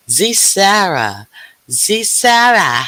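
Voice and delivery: high, loud, slow stretched greeting; loud slow high welcome; drawn-out call. Acted. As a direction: sing out the name like a happy announcer.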